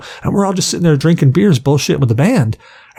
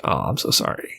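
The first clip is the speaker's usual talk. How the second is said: whispered, much lower and softer sorry; sheepish apology; 11 semitones lower, 9 dB quieter than usual. Retold or imitated. Fan apologizes to angry bassist in a whisper. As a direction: breathe the apology out, embarrassed. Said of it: "I'm like".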